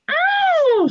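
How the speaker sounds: very high breathy ow; shriek. Acted. Peter hurt by Henry, shrieking. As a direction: shriek in pain. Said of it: "shrieked Peter".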